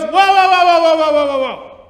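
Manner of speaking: put-on high, loud panicked "whoa"s from a kid; alarmed kid shouting. JV kid at the scale stopping him, high panicked shout. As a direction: yell to stop him before he drops his drawers. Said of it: "this J V kid goes".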